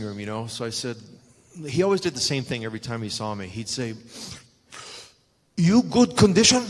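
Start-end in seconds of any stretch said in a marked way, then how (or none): 5.6-6.6 high, loud imitation of a Japanese accent; mock Japanese accent, pitched up. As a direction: play Muta with a Japanese accent in broken English. Imitating Muta's broken English.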